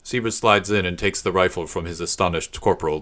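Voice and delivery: usual speaking voice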